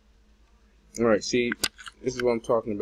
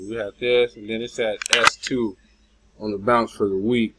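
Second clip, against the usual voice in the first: normal talking voice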